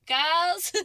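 high, loud sing-song call; calling out girls. Retold or imitated. Mimicking Hannah calling the women again, loud and high. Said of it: "And she's like".

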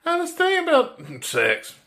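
mock high girl voice that falls away on the last word; put-on falsetto, then your own voice for the punchline; dropping character mid-line. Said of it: "he completely drops character".

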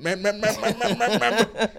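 high buzzing meh sounds, laughing; electrocuted stammer. Retold or imitated. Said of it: "That's what I just did".

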